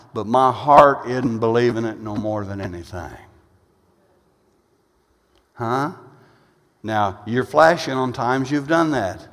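normal talking voice

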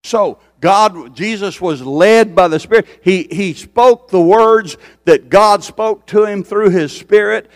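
loud, high-pitched preaching climb; rising revival preacher cry; full-force preaching. Acted. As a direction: preach it out to the back row. Preacher building on the Spirit.

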